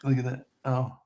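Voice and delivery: usual speaking voice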